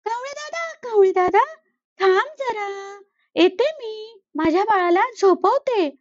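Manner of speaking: sweet and busy, put him off gently; busy mother sparrow. Acted. Sparrow answers the crow while bathing her baby, high and sweet.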